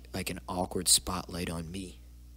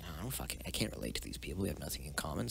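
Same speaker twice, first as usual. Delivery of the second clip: quiet muttered thought; 4 semitones lower, 8 dB quieter, faster than usual. Retold or imitated. Inner thoughts at school, muttered aside.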